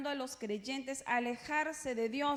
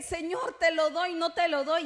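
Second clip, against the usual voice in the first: higher, louder back-and-forth; mock wavering; 4 semitones higher, 6 dB louder than usual. Believer fighting with the Lord over giving, mock wavering. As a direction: dither out loud, raising the voice.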